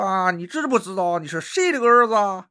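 high, put-on crosstalk-style accent as God; odd comic accent. God asking the sleeper in a silly crosstalk accent. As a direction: ham up a regional crosstalk accent. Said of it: "怎么还这口音呢？".

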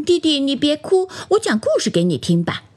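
lightly voiced plush rabbit, starting high; toy rabbit voice. A narrator's reading. Plush rabbit offers crying doll a story, light bunny voice. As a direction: coax like a little bunny, quickening at the end. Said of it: "长毛绒兔子说".

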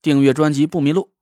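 热情的结尾口播; 响亮地播报节目结尾; 主播口播. Acted. 主播结尾口播. (电台主播腔口播)

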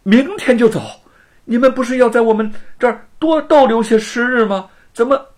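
high, loud stunned disbelief; shocked. A narrator's reading. Wu Cheng'en to departing friends, shocked and loud. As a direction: blurt it out in alarm. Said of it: "吴承恩大吃一惊".

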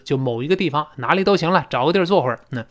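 ordinary delivery

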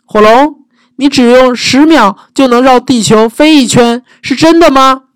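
high, eager wide-eyed question; butter up the dragon; flattering princess. Acted.

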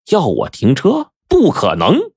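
high-pitched, scornful disbelief; incredulous refusal. A narrator's reading. Bus driver's inner reaction to being told to stop, incredulous. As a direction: throw the demand back, pitch jumping up.

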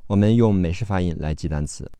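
normal talking voice

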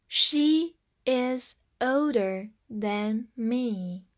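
very slow, word by word; slow model reading. Shown as a demonstration. Teacher models the example for learners, slow and separated. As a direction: leave a gap after each word.

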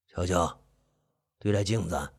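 very low, hushed husky voice; hoarse, low uncle. Acted. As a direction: grumble softly, deep and hoarse. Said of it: "伯父的声音沙哑的有几分低沉".

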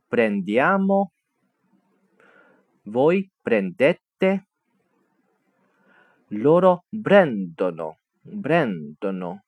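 prendono stressed on the third-to-last syllable, slow; slow drill of the prendere forms; slow conjugation drill. Shown as a demonstration. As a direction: spell out each form slowly, teacher style, stressing pren-. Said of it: "倒数第三个音节重读".

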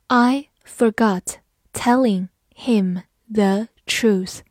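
slow, each word set apart; slow reading. Shown as a demonstration. Teacher reads the second sentence for learners, slowly. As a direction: read it one word at a time.

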